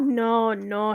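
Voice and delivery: usual speaking voice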